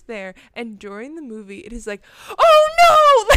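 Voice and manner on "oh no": high, loud cry of alarm; audience screaming. Retold or imitated. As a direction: scream it like a whole theater of kids. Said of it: "everyone is screaming".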